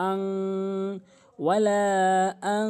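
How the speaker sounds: long "ওয়ালা", then "আং" held humming; nasal ghunnah. Shown as a demonstration. Teacher modelling the phrase, "আং" held nasally. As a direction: stretch "ওয়ালা" and hum "আং" in the nose. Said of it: "এরকম বলতে হবে".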